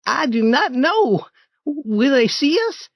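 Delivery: put-on high boyish voice, urgent; a startled boy pointing out a noise; young Tom, alarmed. Tom nudging Huck about a noise, high alarmed boy.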